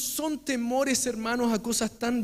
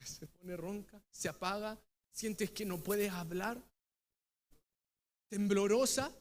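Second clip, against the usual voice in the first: fading fearful voice; 4 semitones lower, 9 dB quieter than usual. Shown as a demonstration. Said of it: "¿qué le pasa a tu voz?".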